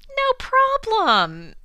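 very high, drawn-out mock agreement; breezy singsong agreement. Host voices Oedipus agreeing to the oracle, high singsong. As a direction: stretch it out high, hamming up the easy agreement.

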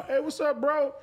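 high-pitched fake-chummy hey; mock friendly greeting. Pushy man greeting the artist on stage, mock chummy. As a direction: pitch it way up, imitating a pushy guy.

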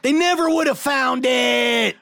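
high, loud, slowed-down yell in a put-on voice; an overcooked gangster shout; Liotta impression attempt. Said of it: "Doesn't even sound like him".

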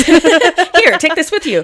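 high playful voice. Pretend. Echoing joke.

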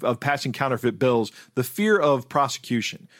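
ordinary talk

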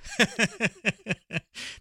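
high, rapid string of chuckles; hearty laugh. Real, not acted. Host amused by the funny accent email, high chuckling laugh. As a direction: break up laughing.

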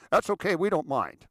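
quick, high 'no problem' voice; breezy reassurance. Retold or imitated. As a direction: brush it off loudly and lightly. Acts out a reply.

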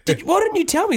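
high, disbelieving question; mock outrage at a mate; retold shock.

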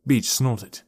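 usual speaking voice

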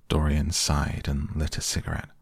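ordinary delivery, nothing marked

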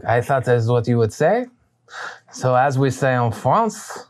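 unhurried villain in fake French accent; reply knowingly in a poor French accent; bad French accent. Villain answering the refusal before combat, bad French accent. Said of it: "My French accent is very bad".

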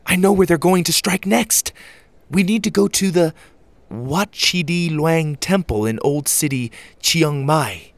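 put-on high, excited girl character; urgent, high-pitched excitement; gasped realization. A narrator's reading. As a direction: blurt out a sudden discovery, pitched high. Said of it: "Susie gasped".

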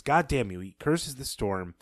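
ordinary talk

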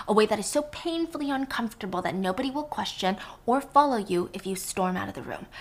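normal talking voice

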